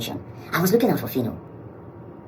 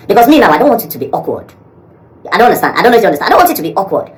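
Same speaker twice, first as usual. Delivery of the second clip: exasperated; 8 semitones higher, 11 dB louder, faster than usual. Real, not acted. Frustrated about awkwardness, pleading.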